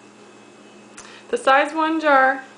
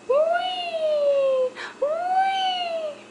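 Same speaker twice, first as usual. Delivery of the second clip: much higher, long gliding whee; playful whee; 13 semitones higher, 4 dB quieter than usual. Acted. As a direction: swoop the spoon in with a high squeal of fun. Mom playing airplane with spoon.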